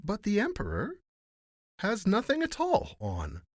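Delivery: put-on high-pitched child's voice; little child. A narrator's reading. Little child remarking on the emperor, childlike. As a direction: pipe up high, like a small child. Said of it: "said a little child".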